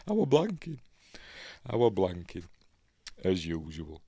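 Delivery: real chuckle running into the words; laughing. Real, not acted. Host finally recalls the name, laughing. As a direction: laugh at yourself, pitch lifting. Laughs at own memory lapse.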